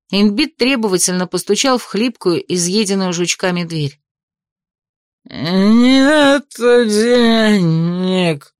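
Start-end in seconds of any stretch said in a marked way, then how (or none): 5.3-8.5 put-on slow slurred drunken mumble. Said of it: "невнятный пьяный голос".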